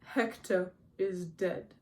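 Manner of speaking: slow, laboured reply; effortful speech. A narrator's reading. Achilles grieving, answering his mother, slow and effortful. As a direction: drag the words out with effort.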